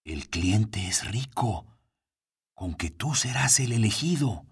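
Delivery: whisper. A narrator's reading. Coffin whispers to narrator.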